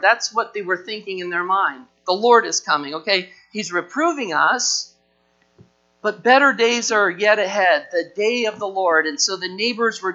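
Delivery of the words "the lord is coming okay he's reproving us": normal talking voice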